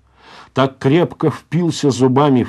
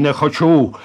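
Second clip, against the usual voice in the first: louder, higher burst of defiance; defiant refusal; 4 semitones higher, 5 dB louder than usual. Acted. Залетаев refuses the староста's order, defiant. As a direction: fling the refusal back. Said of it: "встрепенулся он всем телом".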